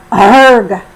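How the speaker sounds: a loud, growling roar; big cartoon lion roar. A narrator's reading. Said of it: "roared Mister Lion".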